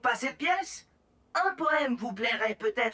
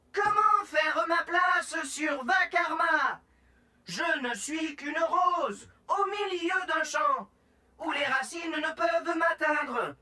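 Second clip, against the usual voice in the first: dramatic recital. Acted. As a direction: declaim it like a tragic poet. Dramatic poem recital at audition.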